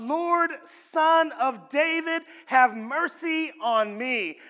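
loud, slow, pleading cry for mercy; desperate cry. A narrator's reading. Woman pleading with Jesus for her daughter, loud slow desperate cry. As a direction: cry out to him for mercy, drawn out.

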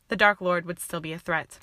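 ordinary delivery, nothing marked

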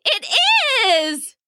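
put-on high, drawn-out little-girl exclamation; excited cry. A narrator's reading. Princess learning about the cat's friend, delighted cry. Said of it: "cried the princess".

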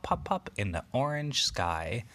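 usual speaking voice

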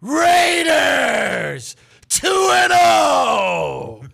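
high, long-drawn bellow; huge drawn-out sports cheer; announcer-style team shout. Shown as a demonstration. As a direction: bellow the team and record, stretching each word. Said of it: "like I just did now".

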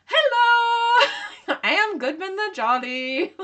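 very high, loud jolly giant voice with laughter; Goodman the Jolly. Retold or imitated. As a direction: boom a jolly hello, laughing. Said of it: "that's exactly how I picture this".

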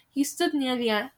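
usual speaking voice

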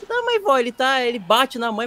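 high-pitched objection to grandma; acted protest. Grandchild objecting to grandma's excuse, high acted protest.

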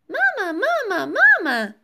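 put-on high, excited little-boy chirp; chirping child. A narrator's reading. Tiny winged boy calling his mother, excited chirp. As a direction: call out like an eager little bird. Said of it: "chirped Philip".

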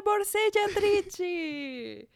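high, drawn-out Italian holler; hammed-up street warning, stretched high; mock public warning. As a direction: sing out a loud pickpocket alert, slowly. Mimics Italian pickpocket warning.

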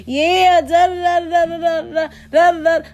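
high, gushing chatter; fake friendly greeting. Faker fronting to her face, high gushing greeting. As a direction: overdo a high girlfriend hello, babbling on. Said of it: "let me get in front of her face".

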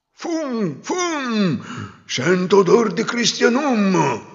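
high, slow sniffing chant; sniffing ogre-like wind. Acted. The wind sniffing out the hidden girl, slow and eerie. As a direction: sniff the air like a hungry monster.

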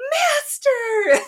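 gasp, then a high, loud cry; hammed-up worshipful gasp and exclamation; mock awestruck cry. Witches mistaking a costumed man for their master, mock awestruck. Witches greeting fake devil, acted.

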